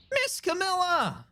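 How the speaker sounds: high, slow, drawn-out puppy call; Missile the little dog. Acted. Streamer voicing the dog calling Camilla, high and slow.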